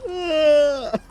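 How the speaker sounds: high sudden yelp of surprise; startled yelp. Acted. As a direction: yelp in shock, half asleep. Half-asleep Ronnie yelps.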